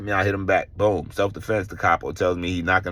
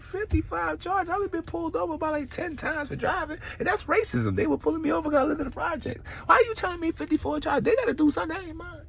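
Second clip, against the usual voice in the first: high, softer mock outrage; indignant disbelief; 4 dB quieter than usual. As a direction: throw the charges back in disbelief. His retort to the girls.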